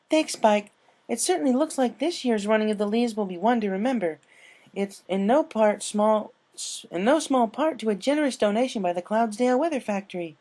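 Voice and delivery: cheerful commentator; co-host announcer. Acted. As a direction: hype up the crowd on air. Twilight co-hosting.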